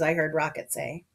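ordinary delivery

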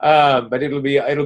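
put-on a lightly done German accent; mock German accent. Coach jokes about making guests talk, in a light mock German accent. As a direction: slip back into a playful German villain voice. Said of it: "I don't know why I'm talking in German".

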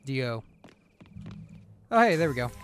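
ordinary talk

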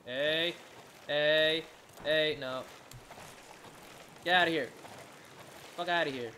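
loud, sharp warding-off calls; shouting it off. Real, not acted. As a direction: chase the attacker away with short shouts. Shouts off an attacking shark.

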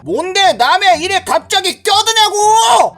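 high, drawn-out shout; angry yell. Acted. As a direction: yell at him for butting in. Bully yells at intervening man. (높게 늘여 버럭 따지는 말투)

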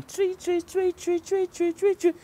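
high, light chirping bird cry; bird call. Acted. Bird calling as it swoops down. Bird calls out while flying.

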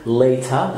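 LATER as "lay-tah"; British later, crisp T; British clear T. Shown as a demonstration. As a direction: hit a true T and drop the R in later. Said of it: "في البريتش إنجلش بنقول".

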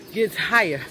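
ordinary delivery

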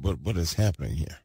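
ordinary talk

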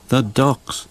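ordinary delivery, nothing marked

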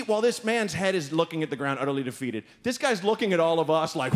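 usual speaking voice

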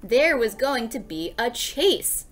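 ordinary delivery, nothing marked

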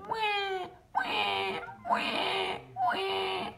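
high mock baby wail; baby cry imitation. A narrator's reading. Reading to a child, mimicking a crying baby. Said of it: "A baby crying".

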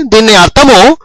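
ordinary talk, nothing marked

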